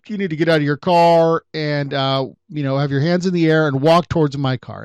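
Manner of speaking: loud barked police order; cop on a loudspeaker. Retold or imitated. Officer orders the private investigator out of his car, loud command. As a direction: call it out like a cop over a loudspeaker. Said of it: "on the loudspeaker".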